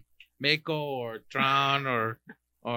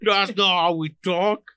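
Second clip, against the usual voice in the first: British Twitch guy imitation; badly faked British indignation; mock British accent. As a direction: play an offended Brit, drawn out. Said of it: "some British guy on Twitch is like".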